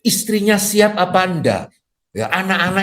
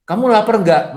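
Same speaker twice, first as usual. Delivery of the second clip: woman asking; 6 semitones higher, 4 dB louder than usual. Retold or imitated. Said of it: "Kalau cewek nanya sama temennya".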